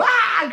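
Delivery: a sudden very high yelp of delight; imitated delighted cry. John's ghost face cries out in glee at the film. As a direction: shriek "Ah!" way up high like a laughing ghost.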